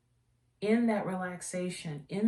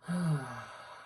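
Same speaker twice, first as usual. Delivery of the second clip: contented sigh; 4 semitones lower, 3 dB quieter than usual. Real, not acted. Smelling a calming candle.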